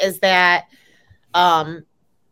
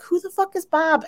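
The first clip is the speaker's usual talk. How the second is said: much higher, baffled mock outburst; as if the name makes no sense at all; incredulous; 12 semitones higher than usual. Watching the show, baffled by the name Bob, high. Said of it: "I was like".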